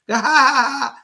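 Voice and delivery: loud real laugh at her own mishap; a loud amused cackle; burst of laughter. Real, not acted. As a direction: let a hearty laugh burst out. Laughing at the tea prank.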